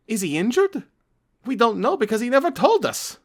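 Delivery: rising alarm from a frightened parent; worried dad. Acted. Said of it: "The dad said".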